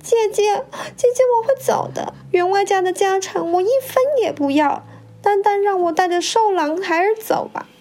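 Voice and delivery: high-pitched tearful begging; sobbing plea. A narrator's reading. Widow begs the first wife for her child, sobbing. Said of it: "抽抽搭搭的说".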